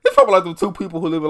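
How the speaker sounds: normal talking voice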